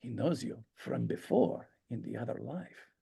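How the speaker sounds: put-on very soft old man's voice; quietly voiced elderly character. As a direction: play the old guy, hushed and confiding. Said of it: "the old guy goes".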